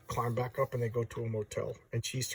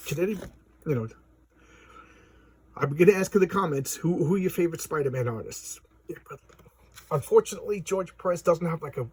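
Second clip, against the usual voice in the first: usual speaking voice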